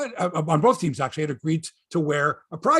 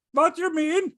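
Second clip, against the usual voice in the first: Samsonov imitation; 13 semitones higher than usual. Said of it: "it's Stan Schmengi".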